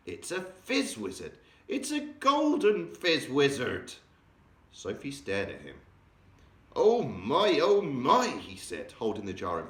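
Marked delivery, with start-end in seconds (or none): 0.1-3.9 high, slow thrilled giant voice; savouring the golden dream in wonder; delighted discovery. Acted. Giant gushes to Sophie over a golden dream, high and thrilled. 6.8-8.3 high, loud gasping delight; can hardly believe his luck. Acted. Giant marvels at the jar before Sophie, high and delighted.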